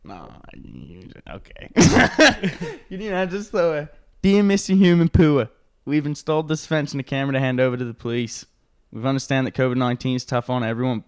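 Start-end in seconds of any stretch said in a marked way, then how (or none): 4.2-11.0 reading in a broad Australian accent; hammed-up Aussie read, fast. Shown as a demonstration. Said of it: "in an Australian accent".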